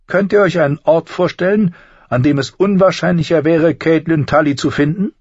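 high-pitched, smug schemer; Littlefinger. Acted. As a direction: lay it out with teasing self-satisfaction. Voices Littlefinger, smug explanation.

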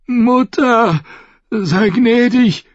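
high, strained plea; dying gasp. A narrator's reading. The bleeding Dornishman prays for mercy, gasping and high. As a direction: gasp out a prayer as you die. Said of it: "keuschte der Dornische".

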